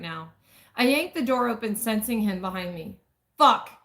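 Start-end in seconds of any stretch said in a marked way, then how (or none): 3.4-3.7 a sudden loud curse; muttered curse. Acted. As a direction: spit it out. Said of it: "he mutters".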